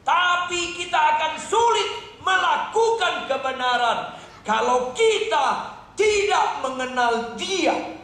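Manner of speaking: high, slow, stretched proclamation; full-force preaching. Real, not acted. Preacher urges believers to abide in God, fervent preaching. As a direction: proclaim it with long, ringing emphasis.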